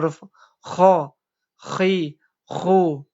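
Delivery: kho, khi, khu all said heavy and thick; thick vowels. Shown as a demonstration. Teacher shows students kho khi khu, all thick. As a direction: keep every vowel heavy. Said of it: "Dibaca tebal semuanya".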